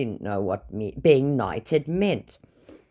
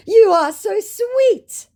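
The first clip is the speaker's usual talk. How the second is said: very high, slow and louder gush; sugary scammer; 8 dB louder, much slower than usual. Retold or imitated. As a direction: coo it out like a lovesick old man. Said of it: "came the rebuttal immediately".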